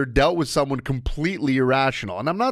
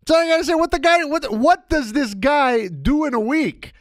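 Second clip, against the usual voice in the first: mock outrage; 11 semitones higher than usual. Mock outrage at absent cohost.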